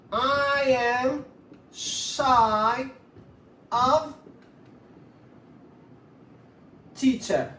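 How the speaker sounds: drawn-out words with long gaps; slow dictation. Shown as a demonstration. Teacher writing an example for students, slow dictation. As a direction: dictate word by word, stretching each.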